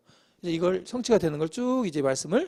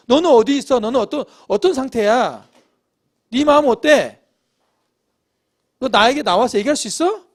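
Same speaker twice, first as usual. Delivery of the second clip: louder, higher pressing questions; God's probing questions; 4 semitones higher, 7 dB louder than usual. Acted. Preacher voices God questioning the congregation, loud pressing questions. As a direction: challenge the listener directly, raised voice. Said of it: "이 질문을 하나님 하시는 거예요".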